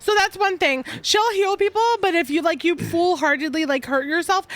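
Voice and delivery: usual speaking voice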